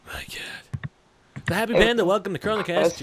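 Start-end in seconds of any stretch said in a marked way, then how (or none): 1.5-3.0 bright radio-host welcome, higher and louder; hammed-up talk-show intro; host announcer voice. Host greets caller on air.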